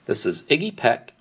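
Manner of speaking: plain reading voice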